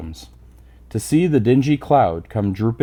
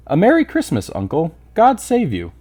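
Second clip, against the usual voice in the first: cheerful cry; 6 semitones higher than usual. Acted. Nephew bursting in greeting his uncle, cheerful and high. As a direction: call it out full of holiday joy. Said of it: "cried a cheerful voice".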